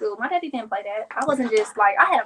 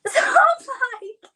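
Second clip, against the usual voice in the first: laughing squeal; 4 dB louder, slower than usual. Real, not acted. Laughing cry mid-story.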